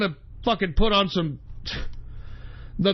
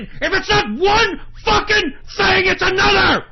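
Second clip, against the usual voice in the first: high, loud enraged scream; real full-force furious yell; pissed-off shout; 11 semitones higher, 5 dB louder than usual. Real, not acted. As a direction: blow up in exasperation. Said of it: "I'm so fucking pissed off".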